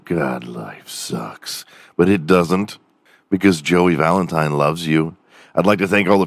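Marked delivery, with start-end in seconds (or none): 0.1-1.7 gloomy sigh. Retold or imitated. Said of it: "you're thinking".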